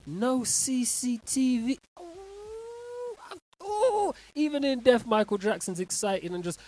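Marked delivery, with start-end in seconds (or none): 0.1-1.8 slow amazed. Real, not acted. Astonished slow repeat. 2.0-5.1 excited. Real, not acted. Excited exclaim.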